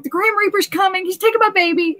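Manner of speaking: high, frantic replay of her own panic; imitating a terrified scream, lightly; mock panicked wail. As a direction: squeal it like you're sure the reaper's at the door. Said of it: "And I'm just screaming and crying".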